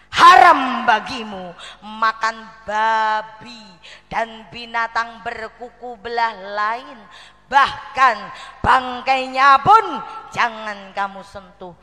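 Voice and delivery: slow, loud proclamation with punched key words; loud opening, weighty measured pace; emphatic scripture quote. Retold or imitated. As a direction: declaim the verse as a stern prohibition. Quotes scripture as a stern warning.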